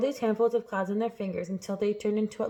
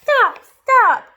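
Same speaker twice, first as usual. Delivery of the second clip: very high, loud pained yells; yelp, then scream for it to stop; escalating cries of pain; cried out; 13 semitones higher, 11 dB louder, much slower than usual. A narrator's reading. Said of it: "he cried".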